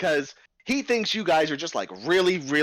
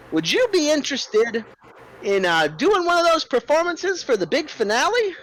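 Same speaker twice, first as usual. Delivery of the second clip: high, loud, eager showman host; a fast-talking circus boss buttering up the bears; the ringleader, high and loud, coaxing a new act; 11 semitones higher, 3 dB louder than usual. Acted. GM voices the ringleader Cliff.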